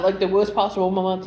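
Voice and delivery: normal talking voice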